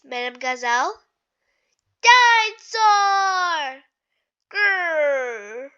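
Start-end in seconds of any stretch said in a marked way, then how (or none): 2.0-3.8 long, loud, drawn-out dinosaur roar; dinosaur growl. Acted. Little George playing dinosaur, long loud roar. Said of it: "growls George". 4.5-5.7 dinosaur growl. Acted.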